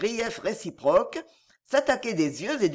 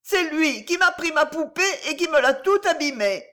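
put-on high-pitched little girl tattling; indignant child's high voice; whining child accuser; 8 semitones higher, 3 dB louder than usual. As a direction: complain like an aggrieved little girl. Little girl accuses her brother.

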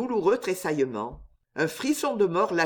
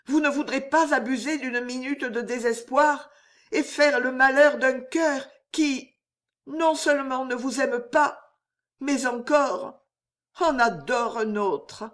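higher-pitched heartfelt plea, slowing at the end; ardent appeal; 6 semitones higher than usual. A narrator's reading. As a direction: confess with restrained fervour, pleading for mercy. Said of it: "murmura la jeune fille d'une voix ardente".

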